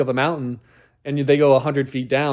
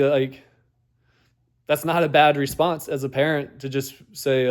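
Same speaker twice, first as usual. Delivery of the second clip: normal talking voice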